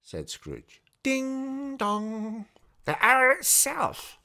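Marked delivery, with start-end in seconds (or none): 1.0-2.5 high ringing ding dong like a clock bell; bell chime. A narrator's reading. Narrator imitating the clock bell, high ringing chime. 2.8-4.2 slow, high and louder in triumph; triumphant. Acted. Scrooge hearing the hour strike, slow triumphant cry. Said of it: "said Scrooge triumphantly".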